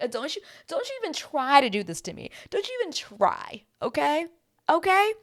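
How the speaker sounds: high-pitched sassy telling-off; pointed, rising "okay?" at the end; indignant warning. Retold or imitated. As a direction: warn him off with mock outrage. Voicing mom's indignant warning.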